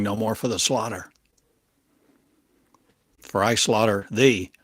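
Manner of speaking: ordinary delivery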